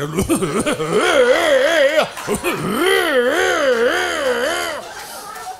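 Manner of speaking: sputtering Model T crank-up, high and wobbly; splutter, then wail like a struggling old motor; old car engine imitation. Comic imitating his morning noises as a Model T, sputtering. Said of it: "I sound like I'm a Model T Ford".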